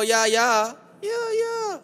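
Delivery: high squeaky crowd cheer; mock crowd cheer. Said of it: "Let me hear you go".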